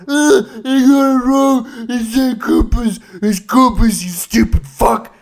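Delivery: loud, high, drawn-out crybaby tantrum; whining five-year-old. Acted. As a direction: wail it like a petulant little kid. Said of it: "five-year-old".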